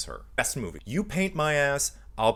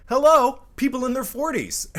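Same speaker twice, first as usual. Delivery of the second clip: loud, high-pitched mock hello; emphatic, high "hello" announcement; exclaiming callout; 12 semitones higher, 8 dB louder than usual. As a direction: call it out sky-high, like the answer is obvious. Mock callout answering own question.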